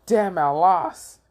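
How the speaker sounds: high, slow mock outrage; angry exclamation. Card player finds his game ruined, angry. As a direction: stretch it out in exasperated anger. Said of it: "He got so angry".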